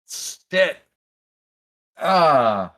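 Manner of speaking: loud pained yelp; pained cry. Acted. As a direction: cry out from sudden pain. Waking in excruciating pain.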